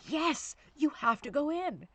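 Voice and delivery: retold parent putting her foot down, loud and slow; high, slow, loud insisting on each word; firm shout.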